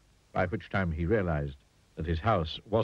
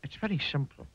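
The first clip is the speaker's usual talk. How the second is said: higher, simple rustic reassurance; kindly tramp; 6 semitones higher than usual. A narrator's reading. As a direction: explain kindly to a nervous chap. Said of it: "continued the tramp".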